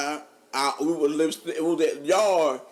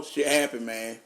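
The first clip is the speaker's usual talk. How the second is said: usual speaking voice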